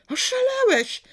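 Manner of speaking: a sudden high-pitched outraged yell. A narrator's reading. Father yelling at his rebellious son in outrage. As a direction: scream it at him in disbelief. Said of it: "wrzasnął profesor".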